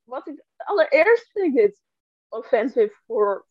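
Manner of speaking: ordinary talk, nothing marked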